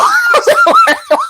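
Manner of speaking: roaring laugh at own joke; big laugh at the punchline. Real, not acted. Loud laugh after joke.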